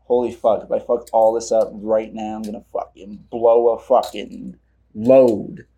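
low, quiet nervous muttering; muttering under breath. Real, not acted. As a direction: mutter it to yourself. Nervous aside while recording.

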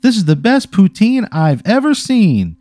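high, slow, gushing commercial voice; hammed-up slogan, swooping up and drawn out; pigtailed lady. Lady admiring the Mountie's poutine, hammed-up. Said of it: "and she goes".

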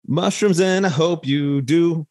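high sing-song warm-up line; mock warm-up. Host playfully continues his vocal warm-up routine. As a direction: keep it high like a warm-up exercise. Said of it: "my vocal warm-ups".

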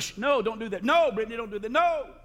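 high, sharp no's that rise; mock parental scolding. Father correcting his daughter, retold high-pitched scolding. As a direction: scold a child in playful reenactment.

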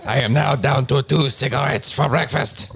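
fake French accent. Mock French lament, shouting.

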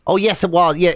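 usual speaking voice

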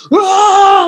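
loud, high frustrated roar; bear-like roar. Retold or imitated. Mother stomping around the house in a fit, loud roar. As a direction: let out a toddler-fit bellow like an angry bear. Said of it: "like a bear".

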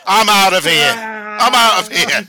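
high, loud hammed-up indignation; shouting in mock outrage at a fixed award; mock storm-off. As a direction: play the offended star walking out.

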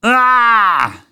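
loud startled cry, pitched high; big sudden yell of surprise; moose-sized yell. A narrator's reading. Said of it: "he heard a familiar moose-sized".

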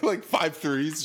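ordinary talk

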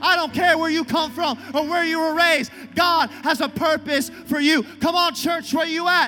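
rally the church to its feet; shouted call to the congregation; preaching at full force. Real, not acted. Preaching climax.

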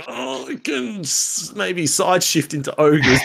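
high, slow, strained mock pondering breaking into laughter; hammed-up hesitation. Player retelling his sideways move into ogres, hammed-up hesitation. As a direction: stretch it out as if talking yourself into it. Acting out reluctant change of plan.

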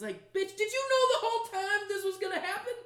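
high, loud exasperated rant; indignant rant. Real, not acted. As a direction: vent at him in disbelief. Ranting angrily at Bran.

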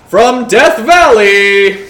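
slow booming ring announcer call. Retold or imitated. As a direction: stretch it out like a boxing announcer. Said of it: "do you just wanna be like".

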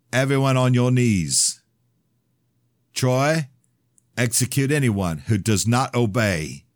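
loud, high commanding order. Acted. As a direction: command the room. Ordering hostages.

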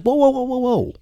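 high-pitched, alarmed run of whoas; alarmed protest. Retold or imitated. Son stopping his dad from leaving him with prisoner, alarmed. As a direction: try to halt someone walking out, voice shooting up.

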